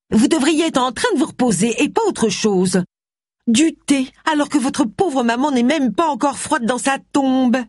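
put-on very high-pitched, indignant old servant; scolding housekeeper. A narrator's reading. Housekeeper reproaches mistress for taking tea, scandalised.

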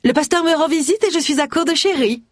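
high-pitched village busybody; pushy Gloria, wheedling. Acted. Neighbour angling for sherry at a widower's door, high and wheedling. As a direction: put on a high, sing-out voice to cadge sherry. Voices Gloria asking a favour.

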